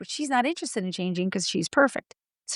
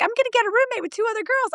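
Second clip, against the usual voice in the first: high, fast daughter voice; imitating daughter; 12 semitones higher, much faster than usual. Mocking the daughter's roommate plan, high and fast. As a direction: chirp it like a breezy teen. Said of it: "My daughter was like".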